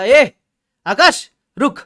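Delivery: loud, high call to stop. Retold or imitated. Shopkeeper calling the customer back, loud. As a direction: stop him with a sharp call.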